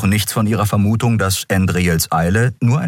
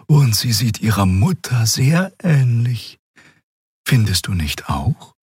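a higher, gentle female character voice; Xea's voice; 5 semitones higher than usual. A narrator's reading. Xea urging Keru to join, lifted voice. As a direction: coax him kindly in a lifted voice.

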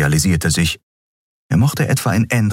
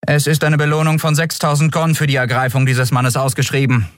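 higher, toneless official statement; toneless guard; 10 semitones higher than usual. A narrator's reading. The guard explains the reward toneless, higher. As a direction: read it out without feeling. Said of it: "erklärte die Friedenswächterin tonlos".

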